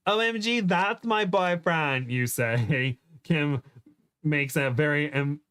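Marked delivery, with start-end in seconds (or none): 0.1-2.1 put-on high, drawn-out girlish gush; gushing excitement. Heroine recognises her boyfriend's voice, high excited gush. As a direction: squeal it like a smitten girlfriend.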